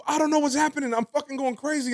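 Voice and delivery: high-pitched pleading freak-out; mock panic. Telling his homeboy he is losing it, mock panicked.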